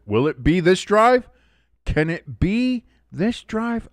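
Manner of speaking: rising, slow hyped question; hopeful hype. Real, not acted. Streamer asking chat about the drive, hyped up. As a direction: build the hope.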